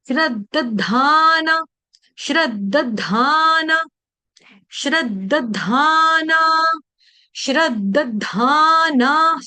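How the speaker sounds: श्रद्धाना drawn out slowly, half द then full द; slow careful syllables. Shown as a demonstration. Teacher repeating श्रद्धाना for devotees, slowly and carefully. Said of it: "नीचे वाला द पूरा, ऊपर वाला द आधा".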